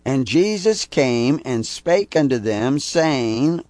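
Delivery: preached Bible quote, slow and lifted; scripture reading. Acted. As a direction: proclaim the verse from the pulpit. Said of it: "It's Matthew twenty-eight eighteen".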